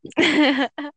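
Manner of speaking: a short high burst of laughter. Real, not acted. Reacts to long serious answer.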